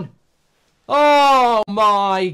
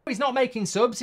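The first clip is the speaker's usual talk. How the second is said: usual speaking voice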